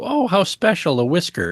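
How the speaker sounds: high-pitched mock delight, falling on the last words; mock wonder. Cat owner finding a first whisker, mock wonder. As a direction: coo over a precious find, hammed-up.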